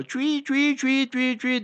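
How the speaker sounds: high squealing siren noise; a playful high-pitched siren imitation; imitated police siren.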